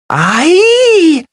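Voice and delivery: a loud high cry from a crowd; a host of Whelm cry out together, loud and high; cried. A narrator's reading. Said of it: "cried a host of Whelm".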